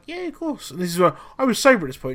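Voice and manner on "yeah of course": much higher, softer timid reply; lightly mocked nervous yes; meek squeak. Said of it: "I was like".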